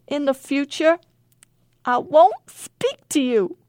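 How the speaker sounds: high, loud squeaky promise; squeaked. A narrator's reading. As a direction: squeak it out, cornered. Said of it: "he squeaked".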